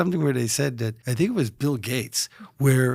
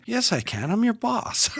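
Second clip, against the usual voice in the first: imitating the boss, much higher on the last words; a playful boss putting an employee in place; hammed-up firm boss reply; mock stern boss; 11 semitones higher than usual. Boss answering employee back.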